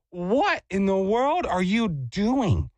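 slow, drawn-out indignant question; indignant retort. Retold or imitated. Cyclist to lady who jumped at his bike, indignant. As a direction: stretch each word out in disbelief. Retold confrontation with jumping lady.

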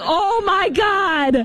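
mock-panicked parent pleading; exasperated homeowner. Homeowner dad telling daughter off the sprinkler, high exasperated plea. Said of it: "I would be like".

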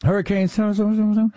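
"Hurricane" running into babbled "so, so, so"; mock garbling. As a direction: babble "so, so, so" in place of the name. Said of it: "watching people try to say".

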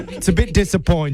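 hammed-up British customer-care agent; bad British accent. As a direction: put on a terrible British accent. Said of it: "do my absolute worst British accent".

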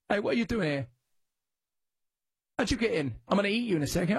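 put-on teasing cat voice in a British accent. Host voicing the zoo cat with a British accent. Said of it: "What's up, British accent".

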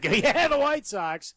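high, drawn-out mocking cackle; imitated mad cackling. Sore fan imitating Steve laughing at his team, mock cackle. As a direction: mimic someone laughing madly as they report it. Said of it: "cackling like a madman".

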